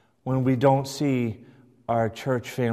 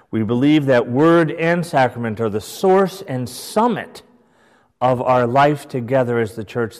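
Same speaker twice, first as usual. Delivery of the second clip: louder, measured reading of a church statement; formal read-aloud; 6 dB louder than usual. Acted. Teacher reading a church statement to the class, louder and formal. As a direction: proclaim it like a creed being read out.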